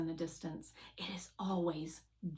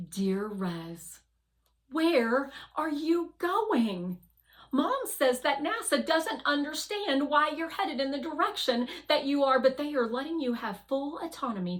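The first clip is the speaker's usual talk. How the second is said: letter voice; 7 semitones higher, 6 dB louder than usual. Acted. Girl's letter voiced by narrator.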